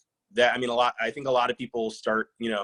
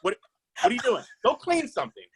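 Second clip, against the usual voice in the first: much higher mock scolding mom; bossy mother; 14 semitones higher than usual. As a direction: nag like a mother sending a kid to chores. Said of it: "she sounds like my mama".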